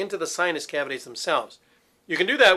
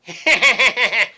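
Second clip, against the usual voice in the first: high cackling witch laugh; short witchy cackle; 6 semitones higher, 8 dB louder than usual. Shown as a demonstration. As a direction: cackle like a nasty Halloween witch. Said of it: "kind of a cackle".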